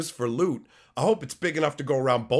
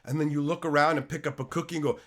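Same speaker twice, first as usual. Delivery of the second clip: ordinary delivery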